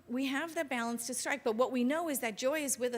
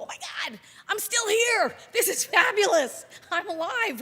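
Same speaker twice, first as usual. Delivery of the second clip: much higher and louder, thrilled to be alive; overjoyed waking up; 8 semitones higher, 9 dB louder than usual. Pretend. Waking up in the morning, thrilled and shouting with joy. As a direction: shout it like the best morning ever. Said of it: "you go".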